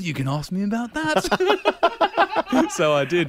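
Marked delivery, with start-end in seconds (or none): 0.0-1.8 slow, higher, lightly voiced prompting bloke; hint gently at the obvious question; prompting quote. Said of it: "And he goes". 1.8-2.6 real laughing burst, high; high burst of laughter. Real, not acted. Laughing at own story.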